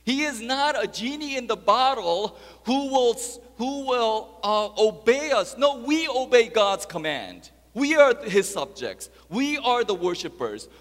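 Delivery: loud, high, emphatic sermon voice; full-force preaching. Acted. Preacher correcting the congregation on who obeys whom, full-force preaching. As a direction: preach it out, driving each statement home.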